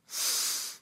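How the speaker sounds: low, soft breathing cue; guided breathing. Shown as a demonstration. Preacher leading a breathing exercise, low meditative voice. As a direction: coach the breath quietly, with pauses.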